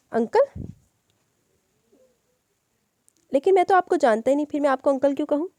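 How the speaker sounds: put-on a small boy questioning a stranger who calls himself uncle; innocent child. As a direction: ask it with a child's naive puzzlement. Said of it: "बच्चा मासूमियत से बोला".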